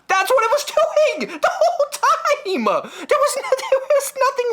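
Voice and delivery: high squeaky laugh-talk. Real, not acted. Comedian laughing at the silly tape, high laugh-talk. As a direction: crack up while telling it.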